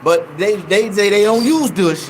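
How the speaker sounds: ordinary delivery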